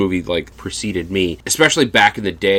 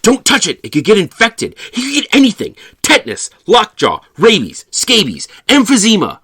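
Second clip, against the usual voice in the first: much higher, louder frantic list; panicky hypochondriac; 13 semitones higher, 6 dB louder than usual. Retold or imitated. Teen warns the girl off the stabbed foot, panicking. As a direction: rattle off diseases in a panic. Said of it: "and Daryl goes".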